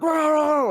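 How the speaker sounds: high frantic Chihuahua bark; imitated dog barking. Imitating a friend's Chihuahua at the door, frantic barking. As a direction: yap like a tiny dog going crazy. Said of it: "it would, like, go ape shit".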